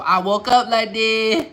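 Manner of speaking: high, loud, showy brag; playful boasting. Pretend. Man showing off his unfixed crown, boastful crowing.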